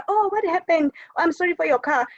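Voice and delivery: high, sympathetic voice; mock sympathy. Teacher voicing a kind friend's sympathy, pitched high. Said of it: "to say".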